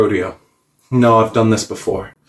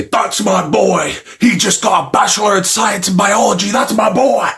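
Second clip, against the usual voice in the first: high, hammed-up proud father; over-the-top fatherly cheering; proud dad Godzilla; 9 semitones higher than usual. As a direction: brag about a son in a put-on voice.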